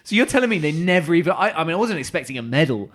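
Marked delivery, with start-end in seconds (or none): 0.0-1.5 high, disbelieving outburst; surprised. Real, not acted. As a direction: sound genuinely shocked. Shocked disbelief.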